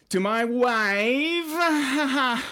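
loud, high-pitched catchphrase impression; Borat. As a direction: stretch the words out slowly in a comic foreign voice. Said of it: "there's a new Borat movie".

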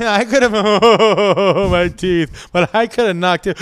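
mock drunk boast, breaking into laughter; laughing drunk imitation. Retelling her drunk friend's boast, laughing. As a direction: laugh through the drunk guy's bravado. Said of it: "He was so drunk that he was like".

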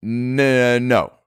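stretched-out N before a halting NO; hesitant pause. Real, not acted. Answering a teasing question about Mary, hesitant pause before NO. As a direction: draw out the N, then land on NO. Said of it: "I don't even know why I paused".

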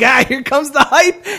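exaggerated fan anticipation; mock hype. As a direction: build up big hype for a letdown. Mock quote of team's hype.

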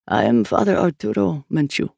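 lightly voiced older man, composed; a measured, studiously calm introduction; calm older priest. A narrator's reading. Priest introduces himself to the detective, studiously calm. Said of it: "studiously calm".

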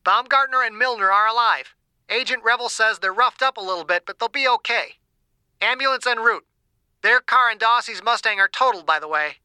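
high, thin earpiece report; small tinny dispatcher voice on comms; tinny radio voice. A narrator's reading. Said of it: "The tinny voice returned".

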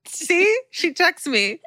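high, excited show-off voice; bragging mom. Acted. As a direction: play a mother showing off to diners. Bragging mother acted in story.

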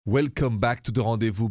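usual speaking voice

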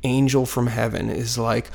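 ordinary delivery

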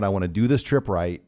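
ordinary talk

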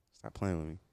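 low, soft, seductive purr; sexy voice. Shown as a demonstration. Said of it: "I put the sexy voice on".

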